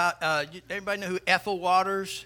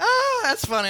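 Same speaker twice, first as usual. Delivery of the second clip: high, tickled exclamation; real amusement; 6 dB louder than usual. Real, not acted. Preacher enjoying his own parrot joke, tickled. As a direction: crack up at your own joke.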